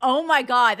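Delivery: usual speaking voice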